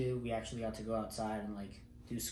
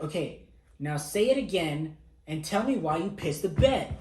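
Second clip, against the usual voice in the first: louder, higher put-on instructor command; imitated instructor order; 6 semitones higher, 8 dB louder than usual. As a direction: demand it like a drill instructor. MTI ordering recruit.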